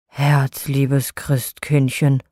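put-on low, slow old Nikolaus voice; Nikolaus giving in. A narrator's reading. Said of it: "rief er".

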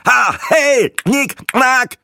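high, separate bursts with popping k sounds; stroboscopic bursts. A narrator's reading. Breitling mocks the click sounds, firing high bursts. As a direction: fire each syllable out in a squawk. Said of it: "feuerte mehrere stroboskopartige".

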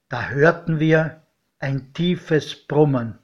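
ordinary delivery, nothing marked

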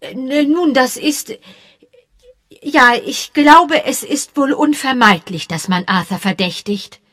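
high, halting, loud hesitation; a flustered lady hedging; faltering. Acted. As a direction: search for words reluctantly.

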